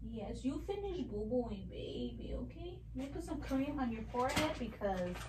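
put-on soft, sing-song baby talk. Mother coos soft questions to her baby. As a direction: coo at the baby softly.

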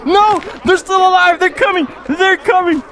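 high-pitched panicky game commentary; alarmed excitement. Real, not acted. Player sees enemies still alive, excited alarm. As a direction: cry out as the enemies close in.

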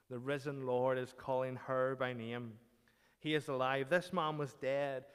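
ordinary talk

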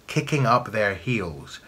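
ordinary delivery, nothing marked

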